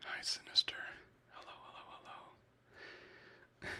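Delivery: breathy close whisper greeting viewers; ASMR whisper. Real, not acted.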